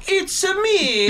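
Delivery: squealed, drawn-out cartoon Italian accent; very high Italian plumber parody, stretched slowly; hammed-up mock Italian, way up high; Jared Leto. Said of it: "and Jared Leto is like".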